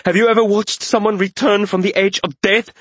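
high, impassioned outburst; shouting. A narrator's reading. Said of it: "the mage whipped around and shouted".

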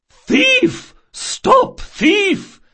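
very high, loud alarm cry; strong holler. Acted. Old shopkeeper from her window to the street, shrieked holler. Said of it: "hollered in a voice of surprising strength".

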